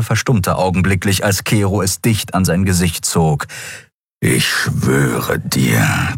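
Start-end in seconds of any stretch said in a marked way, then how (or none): 4.2-6.1 much lower, very slow growl; growling beast. A narrator's reading. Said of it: "knurrte der Skria".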